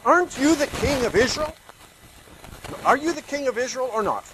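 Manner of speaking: loud, high scornful challenge to the king; demanding. Acted. Jezebel scorning sulking husband Ahab, loud demanding challenge. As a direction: demand it, mocking his weakness. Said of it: "Jezebel demanded".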